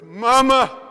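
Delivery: put-on loud high wailing cry of a grieving little boy; child crying out. Preacher voices a small boy crying out for his dead mother, loud and high. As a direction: call out for mama like a lost child. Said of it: "he began to cry".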